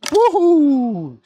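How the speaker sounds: high joyful whoop; excited whoop. Real, not acted. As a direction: let out a big happy whoop. Whoop on a jump.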